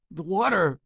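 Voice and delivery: high, loud anguished cry; anguished moan. A narrator's reading. Burgess warning Peters about the water, anguished cry. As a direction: cry out in pain and dread. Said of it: "Burgess moaned".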